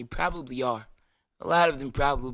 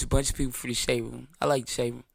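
normal talking voice

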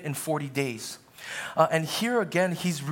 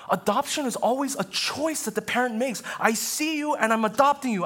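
high, urgent preacher's voice, louder; fervent preaching; 9 semitones higher, 3 dB louder than usual. Acted. Pastor proclaiming Christ's adopting love to congregation, fervent preaching. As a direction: press each line home from the pulpit.

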